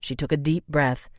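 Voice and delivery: plain reading voice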